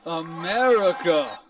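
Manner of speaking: loud, long-drawn mock proclamation; mocking echo of a grand campaign line; mimicking a politician. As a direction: stretch it out like an overblown speech. Mimics the candidate's clip just played.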